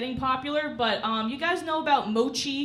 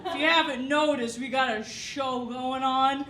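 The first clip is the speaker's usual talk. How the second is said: put-on drunk dad. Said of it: "he's like".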